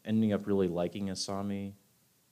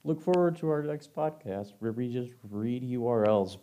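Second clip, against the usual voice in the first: ordinary talk, nothing marked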